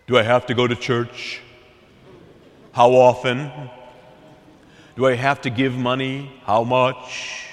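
low, monotone mock negotiating; lawyerly haggling. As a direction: question it on one low note, like a lawyer haggling over terms. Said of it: "We sound more like lawyers negotiating a deal".

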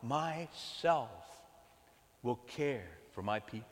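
very slow and soft "I myself"; tender Lord. Acted. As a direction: promise it quietly and gently. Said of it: "the Lord says".

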